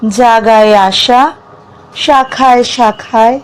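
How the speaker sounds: measured verse reading with long pauses between lines; poem recitation. Acted. Reciting a self-written poem about dreams to listeners, measured poetic delivery. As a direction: recite it phrase by phrase, lifting some line endings, letting others fall.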